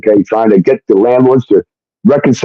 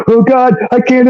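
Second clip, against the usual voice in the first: high-pitched panicky mimicry; put-on high panicked wail; mock scream; 11 semitones higher than usual. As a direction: imitate her screaming in a squeal, without real volume. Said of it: "And she's screaming".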